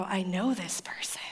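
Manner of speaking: dramatic whisper; hushed revelation. Acted. Wife realizing she knows the caller, whispered. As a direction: let it out in a stunned whisper. Stunned discovery in retold story.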